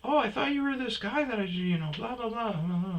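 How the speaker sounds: high, rushed woman imitation; gym lady rambling. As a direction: rattle it off high and bright. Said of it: "And she goes".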